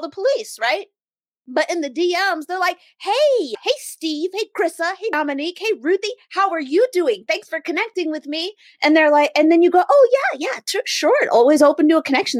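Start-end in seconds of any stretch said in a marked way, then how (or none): 3.0-8.5 high, sing-song chummy greetings; mock-chirpy DM voice; fake-friendly. Mimics fake-friendly direct message sender. 9.9-12.4 high, quick, loud mock politeness; hammed-up friendly yes; eagerly agreeable. Acts out polite reply to networker.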